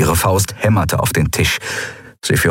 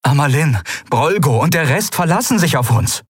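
high-pitched, urgent appeal; pleading; 10 semitones higher than usual. A narrator's reading. Mate begging the captain for his people, pleading. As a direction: beg to be heard, pressing on.

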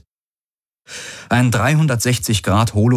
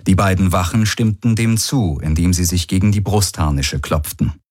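plain reading voice

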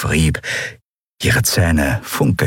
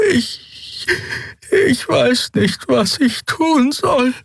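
very high, slow and helpless; timid little Yadi, lost and pleading; small fairy creature voice; much slower than usual. A narrator's reading. Small creature Nelen admits to Miko she's helpless.

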